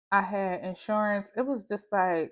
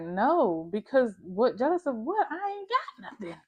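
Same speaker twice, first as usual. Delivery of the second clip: incredulous denial; 4 semitones higher than usual. Retold or imitated. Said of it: "I was like".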